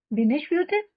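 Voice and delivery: ordinary delivery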